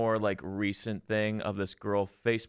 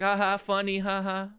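mocking commenter laugh; 8 semitones higher than usual. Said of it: "being like".

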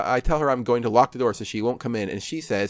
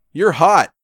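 loud, higher-pitched blurt; yelled out; 6 semitones higher, 9 dB louder than usual. A narrator's reading. Answering her question whether she's attractive, yelled out. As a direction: yell the compliment out. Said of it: "so I yell out".

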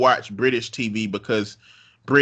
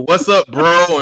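louder, higher hammed-up bro greeting; mock awkward dude greeting; 6 semitones higher, 7 dB louder, slower than usual. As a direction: play a clumsy guy forcing slang.

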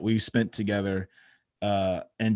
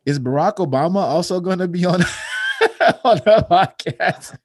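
higher, louder quote breaking into laughter; laughing retelling; 9 semitones higher, 7 dB louder than usual. Retold or imitated. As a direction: crack up while quoting the son's question. Retells son's question, breaking into laughter.

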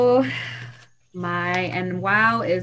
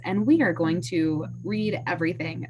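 ordinary talk, nothing marked

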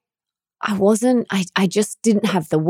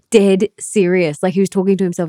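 usual speaking voice

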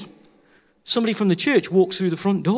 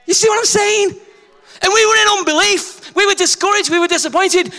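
high, loud preacher's cry; fired-up sermon delivery; preaching; 11 semitones higher, 3 dB louder than usual. Real, not acted. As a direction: preach it out to the hall. Preaching at full force.

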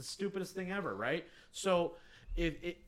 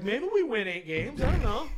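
laughing; 9 semitones higher, 8 dB louder than usual. Retold or imitated. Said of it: "Kyle's like".

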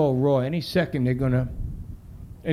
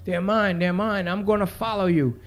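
higher-pitched, lightly voiced frantic mother; overwrought mother; 6 semitones higher than usual. Mother demanding her keys from the cop, overwrought imitation. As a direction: insist like a worked-up mother. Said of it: "like she's gonna have a heart attack".

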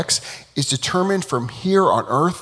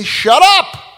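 much higher and louder "Shut up!"; mock shout; 14 semitones higher, 9 dB louder than usual. Students telling the heavenly voice off, shouting.

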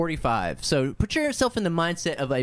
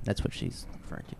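whispered aside; 10 dB quieter, much faster than usual. Real, not acted. Whispered aside mid reading.

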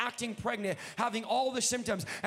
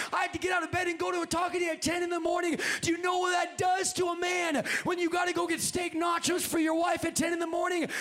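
high, driving comic rant from the pulpit; worked-up preaching; 7 semitones higher, 3 dB louder than usual. Real, not acted. Husband complaining to church about nacho errand, worked-up preaching. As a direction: tell it at a raised preacher's pitch.

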